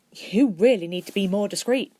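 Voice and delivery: knowing wink. Acted. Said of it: "continued with a wink".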